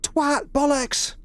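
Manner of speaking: very high, yelped British swearing; Jill scream. Acted. Streamer carrying on their rendition of Jill, high screamed curses. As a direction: squeal out each curse as a separate cry. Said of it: "at least my rendition of Jill anyway".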